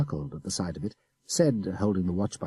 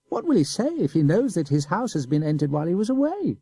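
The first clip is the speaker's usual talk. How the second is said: put-on high boy's voice with a sneer; sneering child; 10 semitones higher, 3 dB louder than usual. A narrator's reading. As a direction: taunt him innocently. Boy keeps taunting.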